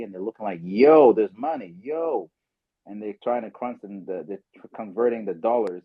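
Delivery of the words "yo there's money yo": slow, loud amazed "yo"; excited friends. Retold or imitated. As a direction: mimic friends stunned by the money. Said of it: "they're all excited about it".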